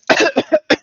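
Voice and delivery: high, harsh choking cough from smoke; strained cough breaking off a line; smoky cough. Acted. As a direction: cough like the smoke caught your throat. Smoke filling the room.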